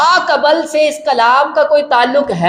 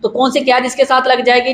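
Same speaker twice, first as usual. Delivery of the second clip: ordinary talk, nothing marked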